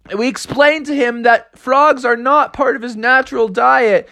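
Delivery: high, earnest little character voice; earnest young frog. Acted. Frog to parents about the shark, high earnest pleading. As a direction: explain eagerly, pitched well up throughout.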